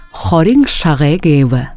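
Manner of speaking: ordinary talk, nothing marked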